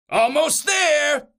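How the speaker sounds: high, drawn-out demon call; stretched, high monster holler; demons' yell. A narrator's reading. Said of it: "yelled the demons".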